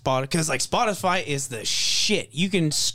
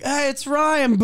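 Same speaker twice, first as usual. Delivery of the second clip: high mock "hey it's Ryan"; mock caller greeting; 12 semitones higher, 4 dB louder, slower than usual. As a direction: toss it off high and mocking. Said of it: "anytime Ryan calls, like".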